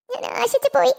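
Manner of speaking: high, upset little plaint; acted disappointment. Child to grandmother over an unaffordable dress, high and disappointed. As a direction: let the letdown lift the voice high.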